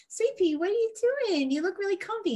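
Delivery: put-on high, sweet baby talk; a owner cooing over her comfy dog in a high voice. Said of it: "I'll be like".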